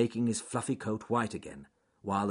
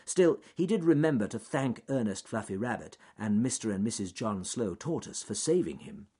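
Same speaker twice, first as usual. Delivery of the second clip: ordinary delivery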